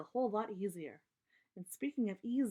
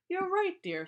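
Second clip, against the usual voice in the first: much higher, louder papa bear; 11 semitones higher, 6 dB louder than usual. Acted. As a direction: voice Papa agreeing cheerfully. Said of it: "said Papa".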